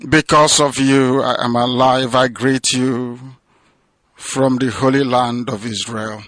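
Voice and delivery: normal talking voice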